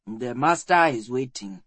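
deep, measured servant's voice. A narrator's reading. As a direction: drop the pitch low for a grave statement. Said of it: "his voice deep".

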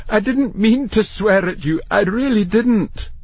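high-pitched, desperate apology; pleading. A narrator's reading. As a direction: plead to be believed. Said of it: "Matthew pleaded".

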